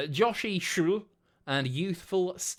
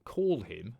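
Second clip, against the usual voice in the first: usual speaking voice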